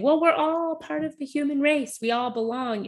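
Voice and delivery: high-pitched, airy quote of a platitude; mock platitude. Speaker quoting a big abstraction about belonging, mock platitude. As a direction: voice an abstract saying, pitched well up. Voicing an abstract reassuring saying.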